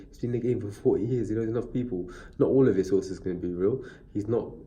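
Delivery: ordinary talk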